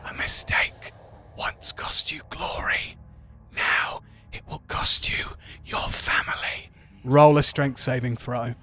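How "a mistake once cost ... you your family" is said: whispered demonic voice; possessed. Acted. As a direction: hiss it like a demon in the head. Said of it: "demonic voice in your head goes".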